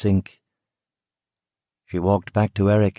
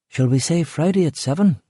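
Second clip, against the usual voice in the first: forced cheer; 8 semitones higher than usual. A narrator's reading. Rachel cheerful.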